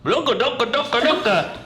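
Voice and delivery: high, mock Arabic babble; mock Arabic gibberish. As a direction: tease with fake Arabic sounds. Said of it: "you'll be like".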